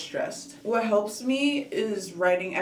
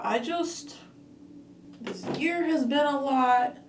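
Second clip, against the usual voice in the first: teary; 7 semitones higher, slower than usual. Real, not acted. Emotional, near tears.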